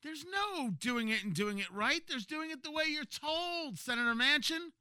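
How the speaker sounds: sarcastic. Retold or imitated. Mocking party line.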